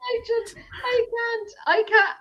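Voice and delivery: high-pitched helpless protest; can't-cope whine; exasperated. Acted. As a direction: give up in comic despair. Acted exasperation at accents.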